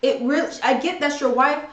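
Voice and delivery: normal talking voice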